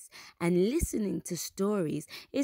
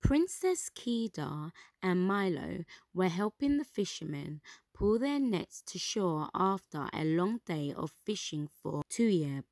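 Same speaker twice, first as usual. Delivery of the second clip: ordinary delivery, nothing marked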